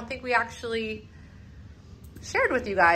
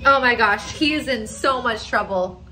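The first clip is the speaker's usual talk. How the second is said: ordinary delivery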